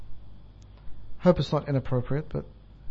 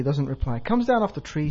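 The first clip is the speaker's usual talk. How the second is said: ordinary talk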